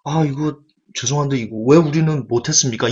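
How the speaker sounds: imitating an awkward, apologetic asker; sheepish apology. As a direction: retell it as embarrassed disciples timidly asking. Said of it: "죄송해가지고".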